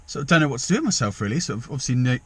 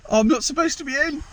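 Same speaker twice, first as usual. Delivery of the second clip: much higher, caught out; startled dismay; 14 semitones higher than usual. Real, not acted. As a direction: realise with a jolt you're not meant to be here. Walks in at wrong time.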